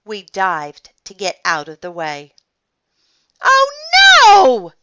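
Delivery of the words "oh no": loud, high cry of dismay; horrified yell. A narrator's reading. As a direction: wail out in alarm. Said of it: "yelled Mister Sunny".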